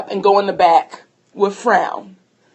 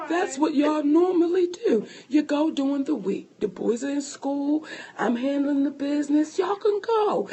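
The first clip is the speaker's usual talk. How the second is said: softly spoken, patient explanation; calm; 3 semitones higher, 6 dB quieter than usual. Retold or imitated. Husband reminding his wife of their routine, calm and soft. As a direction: lay it out gently, keeping the volume down. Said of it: "this is just how calm he's talking to me".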